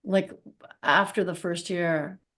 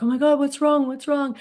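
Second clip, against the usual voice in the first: imitating alarmed kids; 6 semitones higher than usual. Kids worried to see mom crying come home, alarmed and high. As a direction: play the kids panicking at seeing mom cry.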